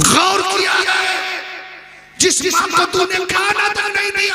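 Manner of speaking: tearful preaching shout. Real, not acted. Preacher scolding sons who starve mothers, tearful shout. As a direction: cry out the reproach with breaking voice.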